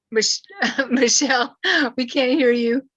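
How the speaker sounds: amused, laughing as you call to someone. Real, not acted. Host laughing at unheard speaker.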